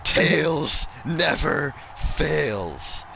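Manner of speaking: slow, high singsong boast; gloating. Real, not acted. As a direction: savour every word. Gloating catchphrase, drawn out.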